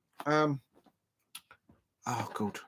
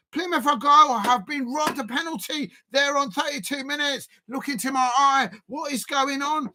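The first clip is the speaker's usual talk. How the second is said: raised pitch, exasperated rant at the referee; indignant protest; 5 semitones higher than usual. Real, not acted. Fan accuses referee of favouring Liverpool, indignant. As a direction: protest the decision in disbelief.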